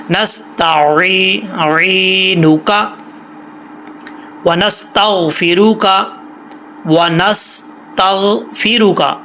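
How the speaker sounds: नस्तईनुका with the ई held long; slow recitation. Shown as a demonstration. Teacher reciting correctly, slow and drawn out. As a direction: stretch the long vowel. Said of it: "लंबा किया जाएगा".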